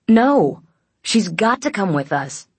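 high, loud, insistent objection; urgent protest. A narrator's reading. Aiden objecting that she must come, urgent. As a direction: burst out in protest, pitch up.

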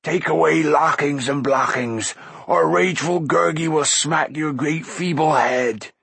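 mild shout in an odd creature voice; shouting Gurgi. A narrator's reading. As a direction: creature crying out at a foe. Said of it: "shouted Gurgi".

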